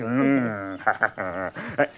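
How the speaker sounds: deep rueful chuckle; a low laugh at himself. Acted. Laughter after comic memory.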